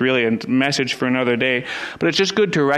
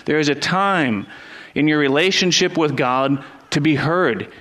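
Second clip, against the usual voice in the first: ordinary talk, nothing marked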